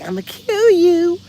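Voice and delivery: high, slow put-on threat; dog's menacing voice. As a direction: growl a silly threat up high. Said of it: "was like".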